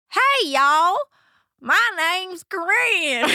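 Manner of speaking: loud, high Southern drawl; big, drawn-out Southern twang on a pageant stage; Southern accent. Retold or imitated. Child contestant showing off accents to a pageant audience, exaggerated Southern accent. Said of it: "I will be doing accents".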